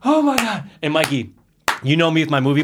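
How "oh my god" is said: panicked exclamation. Retold or imitated. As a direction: yelp like you just missed the start. Panicking at missing the start.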